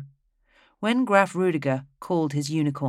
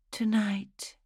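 unvoiced whisper; 6 semitones higher, 6 dB quieter than usual. A narrator's reading. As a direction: breathe it as a secret. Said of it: "she had whispered".

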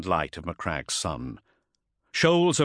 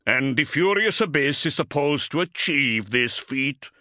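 gruff Space Wolf scoff, higher pitch; 7 semitones higher, 4 dB louder than usual. A narrator's reading. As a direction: scoff at an absurd plan. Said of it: "scoffed Bringar".

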